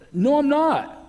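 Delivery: high, loud mock protest; indignant denial. Guy answering a stranger who called him gentle, indignant denial. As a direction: imitate an offended guy, pitch shooting up.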